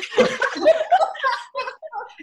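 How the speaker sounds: high bursting laugh; big laugh. Real, not acted. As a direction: laugh out loud at the memory. Laughing after Gary story.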